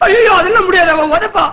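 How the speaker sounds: high, whining refusal; alarmed protest. Acted. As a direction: protest in comic panic.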